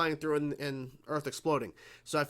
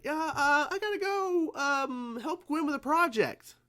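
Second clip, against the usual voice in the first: put-on high, stuttering squeak of a caught kid; nervous stammering excuse; 11 semitones higher, 3 dB louder, slower than usual. Ben making excuses to his parents, high nervous stammer. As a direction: fumble it out like a busted teenager. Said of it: "he was like".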